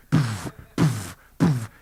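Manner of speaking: dismissive poof. Retold or imitated. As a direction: blow each poof away, unbothered. Michael walks away dismissively.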